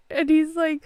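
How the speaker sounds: ordinary delivery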